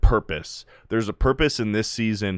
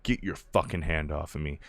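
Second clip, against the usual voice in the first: low growl; 5 semitones lower, 7 dB quieter than usual. Retold or imitated. Butcher shoves Ryan off.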